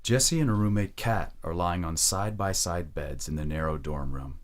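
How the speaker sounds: ordinary talk, nothing marked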